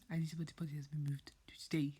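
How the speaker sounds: hushed, breathless whisper; whispered news. A narrator's reading. Woody whispers the news to the toys. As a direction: whisper the big secret. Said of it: "he blurted out the big news".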